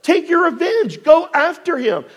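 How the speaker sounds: high-pitched, insistent urging; urgent egging on. Acted. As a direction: urge him on like an impatient comrade. Acted urging of David's men.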